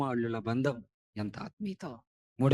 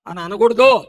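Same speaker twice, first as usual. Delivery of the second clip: much louder stern warning; 9 semitones higher, 17 dB louder than usual. Acted. Preacher forbidding fathers' words, loud warning. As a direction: forbid it forcefully. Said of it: "loud take then quiet echo".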